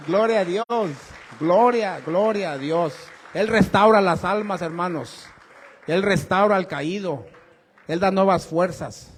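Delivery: loud, fervent praise from the pulpit; fervent preaching. Acted. A preacher praises God to the congregation, loud and fervent. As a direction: proclaim it with full force.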